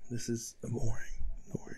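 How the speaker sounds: bored whisper. Real, not acted. Bored on empty livestream.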